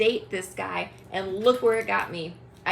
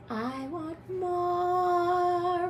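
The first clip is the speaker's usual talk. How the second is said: high, very drawn-out mocking imitation; mock greedy husband; 8 semitones higher, much slower than usual. Wife mimicking husband for whom it wasn't enough, mocking. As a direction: stretch the last word out long and high. Mocks Ryan never having enough.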